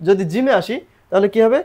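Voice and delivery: normal talking voice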